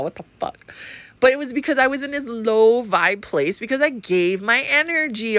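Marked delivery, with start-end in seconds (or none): 0.0-0.7 whispered, softer mock disbelief; breathy aside. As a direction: drop to a whisper for the punchline. End of the retold soda rant.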